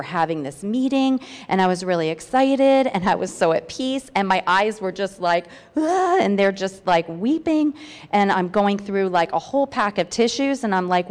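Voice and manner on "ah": mock overwhelmed cry. Describing her weeping eyes.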